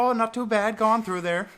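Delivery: high, loud Canadian border guard; hammed-up cheery Canadian accent. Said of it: "have to have the right voice".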